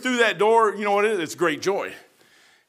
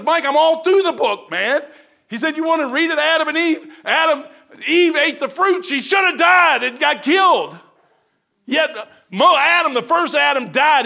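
shouted, higher voice of God; God proclaiming; 8 semitones higher, 6 dB louder than usual. Retold or imitated. God shouting to Mike about Adam and Eve, high and loud. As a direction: preach it as God at full force.

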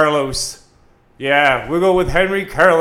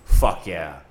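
ordinary delivery

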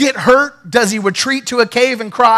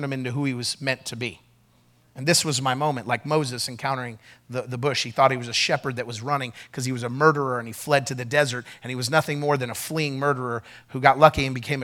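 soft, much quieter, lower storytelling; quiet personal testimony; 9 semitones lower, 11 dB quieter than usual. Real, not acted. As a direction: draw them close, softly.

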